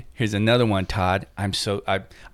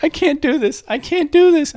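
much higher, mock tearful panic; panicked crying plea. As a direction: imitate a frightened friend about to cry, pitched way up. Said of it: "She's like".

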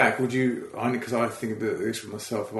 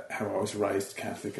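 ordinary talk, nothing marked

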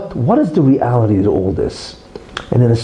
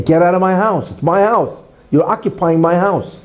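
raised, higher voice, hammering the claim; mock screaming; 6 semitones higher than usual. Intruder yelling at the owner to get out, half-hearted mock shout. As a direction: yell it like an intruder claiming the house. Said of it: "A fight means screaming at each other".

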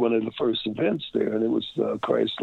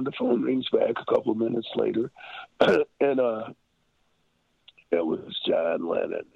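ordinary delivery

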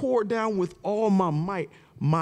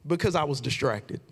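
preaching; 8 semitones higher than usual. Real, not acted. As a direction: drive the point home to the congregation. Preaching the lesson at full force.

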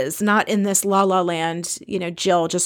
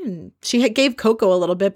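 ordinary talk, nothing marked

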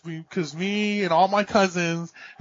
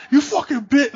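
mock angry outburst; 7 semitones higher, 4 dB louder than usual. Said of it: "someone gets, like, crazy".

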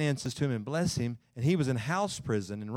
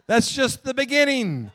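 laughing; 12 semitones higher, 6 dB louder than usual. Real, not acted. Laughing at own joke about children.

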